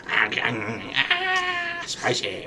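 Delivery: drawn-out mock pained ahs; imitating spice-struck kid. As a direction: wail and babble as if burnt by spice. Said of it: "little Kimmy has teriyaki, mustard, jalapeno".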